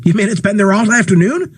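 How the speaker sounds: a touch of shocked disbelief; alarmed question. Acted. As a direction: ask it in rising alarm.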